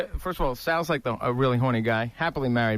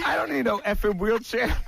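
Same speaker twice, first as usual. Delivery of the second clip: higher, scoffing mock retort; mock indignant; 7 semitones higher than usual. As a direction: bristle at the offer, hammed up. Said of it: "he goes".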